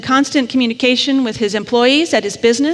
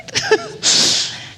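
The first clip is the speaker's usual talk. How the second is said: choked sob. Real, not acted. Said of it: "I'm gonna get a little emotional".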